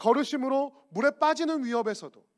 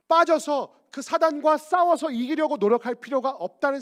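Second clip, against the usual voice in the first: raised, pressing pulpit voice; loud, higher sermon delivery; forceful preaching; 4 semitones higher, 8 dB louder than usual. Real, not acted. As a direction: drive each phrase out to the congregation.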